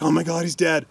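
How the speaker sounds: alarmed wrangler. Retold or imitated. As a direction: imitate a wrangler fearing the worst. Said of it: "the poor wrangler's like".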